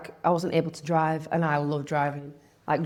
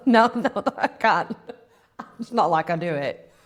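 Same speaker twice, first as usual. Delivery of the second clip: laughing; 3 semitones higher, 3 dB louder than usual. Real, not acted. Laughs at own irony.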